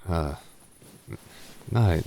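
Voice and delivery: normal talking voice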